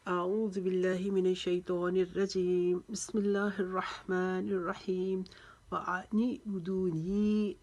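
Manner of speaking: slow, drawn-out Quranic chant; Quran recitation. Shown as a demonstration. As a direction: recite slowly with tajweed, stretching the vowels.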